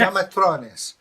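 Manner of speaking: put-on loud, high Eastern European accent; mangled Eastern European pronunciation. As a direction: repeat it emphatically in a thick Eastern European accent. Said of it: "an Eastern European bloke".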